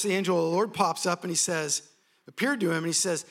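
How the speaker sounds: ordinary talk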